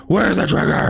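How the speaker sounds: lightly done gruff Batman impression; a quick hint of the Bale growl.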